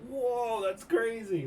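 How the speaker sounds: high, drawn-out awed reaction; retold amazement. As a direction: imitate a group of astonished onlookers.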